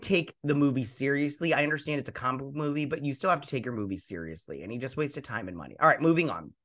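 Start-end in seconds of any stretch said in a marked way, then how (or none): none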